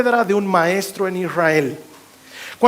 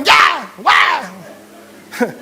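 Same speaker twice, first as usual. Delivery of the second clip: imitated taekwondo yells; 3 semitones higher than usual. Preacher showing how everyone yelled in taekwondo, imitated yells. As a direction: mimic a class's explosive kiai shouts. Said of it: "Todos gritaban".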